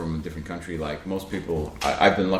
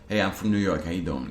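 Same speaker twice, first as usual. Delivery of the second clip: New York accent, pulled back and easy; understated New York accent; 3 semitones lower, 4 dB quieter than usual. Shown as a demonstration. Showing you needn't push so hard, light New York accent. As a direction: let the New York accent just sit there, unforced. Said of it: "just pull back a little bit".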